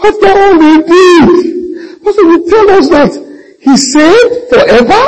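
loud, very high mock wail; mock crying. Sister protesting her father's salvation to her pastor, mock crying. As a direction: wail in disbelief, like a tearful complainer. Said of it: "she came and she's crying".

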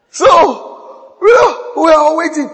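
high, loud excited telling; excited outburst. Retold or imitated. Pastor telling the church how they all waited, excited. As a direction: burst out with the thrill of the wait.

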